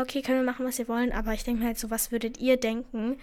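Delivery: usual speaking voice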